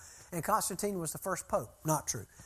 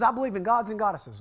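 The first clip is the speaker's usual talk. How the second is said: loud, higher mock indignation; imitated anger; 4 semitones higher, 8 dB louder than usual. Mad bus driver states his Wiccan beliefs, imitated anger. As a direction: retort like an offended believer, louder. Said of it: "this guy just flew mad".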